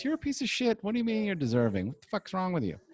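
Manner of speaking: mock the self as a cruel inner voice; harsh inner voice. Inner critic berating him after claiming he's deserving, high sneer. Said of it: "there's a little voice goes".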